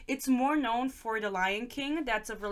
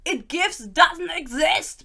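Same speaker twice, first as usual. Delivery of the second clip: loud, high, drawn-out mock yell; slow, high-pitched shouted correction; yelling teacher; 7 semitones higher, 10 dB louder, much slower than usual. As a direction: imitate an exasperated English teacher yelling at a student. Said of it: "starts yelling at him".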